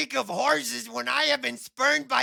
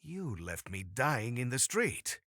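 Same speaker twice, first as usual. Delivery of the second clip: deep character voice; 11 semitones lower, 4 dB quieter than usual. Acted.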